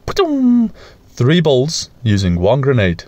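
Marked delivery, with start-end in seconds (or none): 0.1-0.7 high mouth-made gun blast "pa-choom"; mock gunshot. Firing at a bull, high mock gunshot. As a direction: voice the shot like a kid's toy gun.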